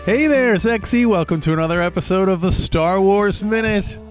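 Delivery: ordinary talk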